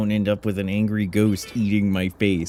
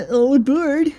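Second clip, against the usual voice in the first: much higher, louder cartoon call; squeaky call; 3 dB louder than usual. Character calling everyone aboard, high squeaky voice. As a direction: sing out the boarding call in a squeak. Cheery call to board ship.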